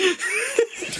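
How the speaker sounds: high cackling laugh. Real, not acted. Cohost laughing at the cop joke, high laugh. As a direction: crack up.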